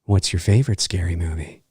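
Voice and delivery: low, soft, almost normal menace; a hint of a calm killer asking; lightly voiced Ghostface. Host imitating Ghostface quizzing Casey, low and softly sinister. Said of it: "he's like".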